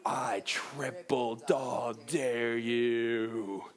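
very slow, low, drawn-out slow-motion voice. Acted. Acting out the Christmas Story dare, in deep slow motion. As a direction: stretch every word deep and long, like slowed film. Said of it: "It's like in slow motion".

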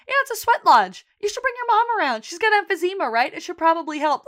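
high, loud, breezy chatter, imitating her; chirpy retold neighbor greeting. Sheila calling out to her neighbor about the sweat lodge, chirpy. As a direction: sing it out brightly as if nothing's wrong.

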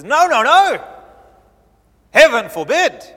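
loud, high, horrified no no no; alarmed protest. Retold or imitated. Peter protesting to Jesus, a loud horrified no. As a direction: shout it like a frightened friend.

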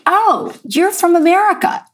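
high, bright American English accent, imitated. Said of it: "in English with an American accent".

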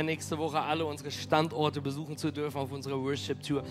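ordinary delivery

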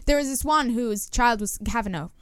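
ordinary talk

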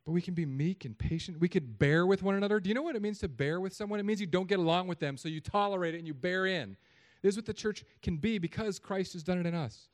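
loud and high, pressing the congregation; impassioned preaching. Real, not acted. Preacher telling church to bear with one another, impassioned. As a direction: raise the voice and preach it hard.

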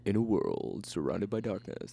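lightly mock a dramatic trailer voiceover. Parodies movie trailer opening.

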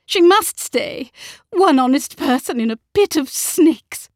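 high, loud, insistent anger; snapped. A narrator's reading. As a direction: insist on it fiercely. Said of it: "snapped Mamma".

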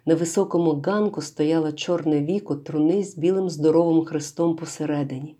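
plain reading voice

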